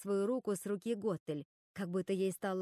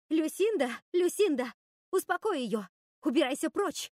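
high, loud cries for help; panicked calling; 7 semitones higher, 6 dB louder than usual. A narrator's reading. Руби calls Люсинда to restrain Готель, panicking. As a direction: call out in alarm, voice pitched up.

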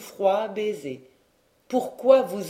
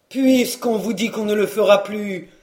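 loud, rising irritation in a rustic voice; beginning anger; 5 dB louder than usual. A narrator's reading. Servant turning cross at his weeping master. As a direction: snap back, half hurt and half cross. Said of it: "avec un commencement de colère".

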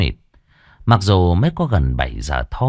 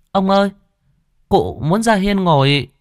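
put-on higher-pitched little girl calling out; child calling grandfather; 10 semitones higher than usual. A narrator's reading. Granddaughter calls grandfather about great-grandmother's wish, high calling voice. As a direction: call to grandpa in a high girl's voice. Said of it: "nó cất tiếng gọi ông nội".

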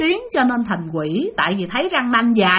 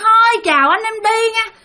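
much higher, eerie ghost woman's voice; vengeful ghost Bình greeting the grandfather, shrill and eerie; 12 semitones higher, 4 dB louder than usual. Acted. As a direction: play the dead woman, high and unsettling. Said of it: "giọng bà thấy ghê lắm, the thé".

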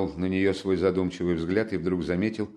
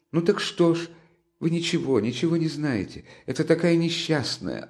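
high, pleading, near tears; anguished defence; 6 semitones higher than usual. A narrator's reading. Sonya passionately defends Katerina to Raskolnikov, high and pleading. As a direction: plead her case in anguish.

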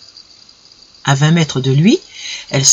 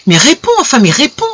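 higher, exasperated insistence; agitated, raised-pitch repeat; overwrought demand; 8 semitones higher than usual. A narrator's reading. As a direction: push them to answer, losing patience. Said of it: "cette impassibilité surexcitait encore davantage".

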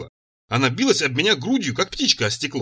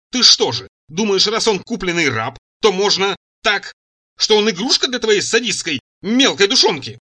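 loud, raised, indignant tirade; breathless fury; 5 semitones higher, 4 dB louder than usual. A narrator's reading. Цира accuses him of treating a slave as a toy, furious. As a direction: pile on the accusation loudly, stretching words for emphasis. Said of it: "Она задохнулась".

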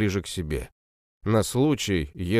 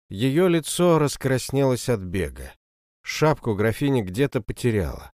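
usual speaking voice

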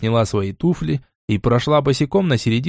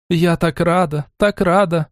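overjoyed; 5 semitones higher, slower than usual. A narrator's reading. Overjoyed aunt greeting.

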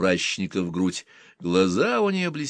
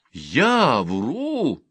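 indignant; 6 semitones higher, 3 dB louder than usual. Acted. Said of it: "возмутилась Рутта".

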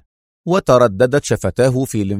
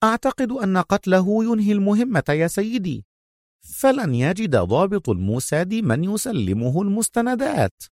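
calm Mona; 9 semitones higher, 4 dB quieter than usual. A narrator's reading. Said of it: "قالت منى بهدوء".

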